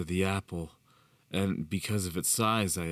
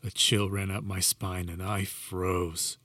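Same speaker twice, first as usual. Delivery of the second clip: ordinary delivery, nothing marked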